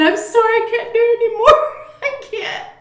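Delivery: high, loud, cracking up mid-sentence; busting up laughing. Retold or imitated. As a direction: lose it and squeeze the words out through laughter. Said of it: "busted up laughing. I just lost it".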